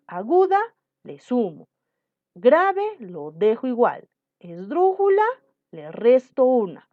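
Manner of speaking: teacher reciting the accent rule; emphatic rule recital. Shown as a demonstration. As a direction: call out aguda, grave, esdrújula with a push. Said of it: "la ley de acentos".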